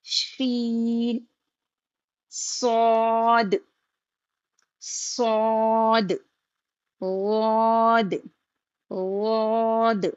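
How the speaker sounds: each letter held very slowly; slow, held letter drill; drawn-out letters. Shown as a demonstration. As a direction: stretch every letter name long. Slow elongated letter recitation.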